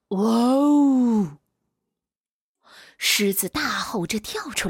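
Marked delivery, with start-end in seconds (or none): 0.1-1.4 loud startled gasp into a wow; a sharp gasp, caught by surprise. Acted. (受惊的倒吸气)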